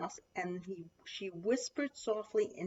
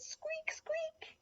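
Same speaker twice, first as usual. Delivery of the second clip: two very high, soft squeaks; tiny squeaks; 8 dB quieter than usual. A narrator's reading. Little Lion trying to roar, tiny high squeaks. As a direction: squeak twice, small and high. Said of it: "he said".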